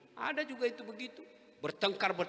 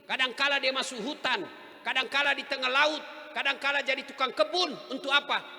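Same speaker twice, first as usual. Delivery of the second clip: fervent preaching; 6 semitones higher, 5 dB louder than usual. Real, not acted. Preacher listing husband's hardships.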